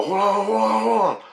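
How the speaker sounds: high, sustained, stretched-out cry; acted excited hold-on. Dreamer stalls family to test creating, high excited call. Tells family to wait, reenacted.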